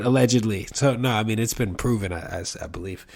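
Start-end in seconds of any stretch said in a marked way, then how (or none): none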